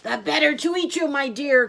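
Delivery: high, forceful greedy exclamation; wolf's cry. Acted. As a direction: cry it out as the wolf drops the disguise. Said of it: "cried the wolf".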